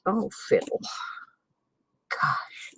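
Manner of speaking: ordinary delivery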